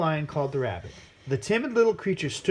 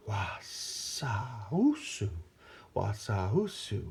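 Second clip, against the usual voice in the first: low, slow snake hiss; slithering hiss; 6 semitones lower, 5 dB quieter, much slower than usual. A narrator's reading. Slow low snake sound. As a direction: python slithers past the animals.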